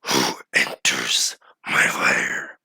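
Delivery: very low, slow creature voice; deep, drawn-out monster challenge; dragon voice. Acted. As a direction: rumble it slowly like a dragon guarding its cave. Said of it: "you just hear a voice reverberating throughout".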